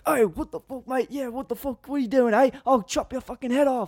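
high mock Australian yell; hammed-up angry Aussie driver; Australian accent. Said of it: "yelled at in that Australian slang".

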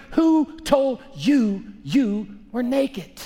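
very slow, drawn out; slow divine question. Acted. God asking Adam and Eve, very slow. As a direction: stretch every word of the question.

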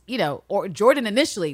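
ordinary talk, nothing marked

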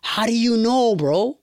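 high-pitched incredulous question; mock-baffled "how on earth" voice; retold amazement. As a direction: quote yourself asking, baffled and high. Retelling his amazed question.

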